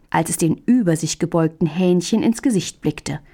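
ordinary delivery, nothing marked

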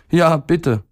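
put-on high little-boy voice; pleading boy. A narrator's reading. Boy begging his stomach to take over, pleading.

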